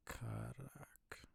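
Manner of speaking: barely voiced whisper, under the breath. Real, not acted. Gamer murmuring a place name to himself, whispered.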